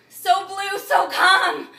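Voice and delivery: high, loud declamation; ringing, anguished outburst; crying out. Acted. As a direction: cry the words out to the heavens. Acted monologue, cried out.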